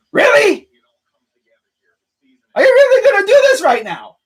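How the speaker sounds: high exasperated challenge. Real, not acted. Challenging the rooster that keeps crowing, exasperated. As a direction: square up to the rooster, loud and high.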